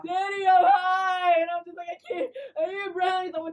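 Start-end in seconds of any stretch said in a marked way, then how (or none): 0.0-1.4 high, loud, drawn-out crying; exaggerated tearful wail; mock wailing confession. Retold crying confession to father. 1.9-3.3 high, hammed-up whimpering; exaggerated crying voice; mock tearful plea. Retold panicked plea to father.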